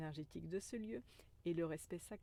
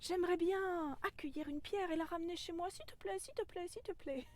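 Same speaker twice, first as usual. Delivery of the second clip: much higher pleading little voice; begging inner child; 10 semitones higher than usual. Pretend. Inner voice begging to take a stone home, high and pleading.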